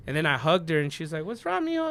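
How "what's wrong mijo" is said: lightly voiced mother, pitched much higher; mom asking gently. Mom voiced in retold hug.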